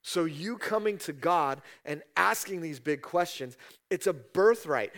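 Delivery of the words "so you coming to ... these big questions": ordinary talk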